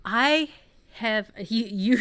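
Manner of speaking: normal talking voice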